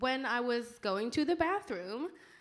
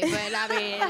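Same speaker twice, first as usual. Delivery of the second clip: high, laughing through the words; 9 semitones higher, 6 dB louder than usual. Real, not acted. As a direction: laugh as you say it. Laughing while speaking.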